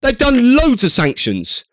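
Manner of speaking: high, sharp outraged denial; incredulous retort. Real, not acted. Commentator shouting back at a clip, high and incredulous. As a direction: cut him off in disbelief.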